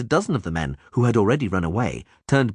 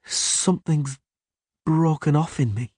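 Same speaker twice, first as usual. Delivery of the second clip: slow, unnatural; 7 semitones higher than usual. A narrator's reading. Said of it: "the girl said very slowly, and very unnaturally".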